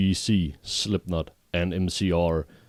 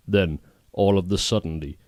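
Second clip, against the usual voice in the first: usual speaking voice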